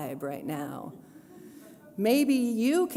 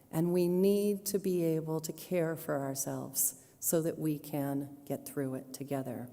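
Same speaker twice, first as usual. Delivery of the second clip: closing benediction; 3 semitones lower than usual. Acted.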